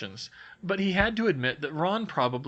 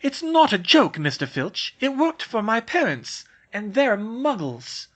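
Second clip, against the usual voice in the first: put-on higher, urgent girl's voice; louder earnest schoolgirl; insistent Hermione; 5 semitones higher, 5 dB louder than usual. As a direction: plead quickly before he shoves.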